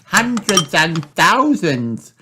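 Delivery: high, slow, thrilled exclaim; a little girl amazed at the mess; excited cry. A narrator's reading. Peppa marveling at pouring batteries, high and excited. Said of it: "cries Peppa".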